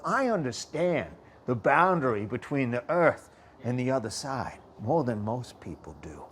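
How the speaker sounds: ordinary talk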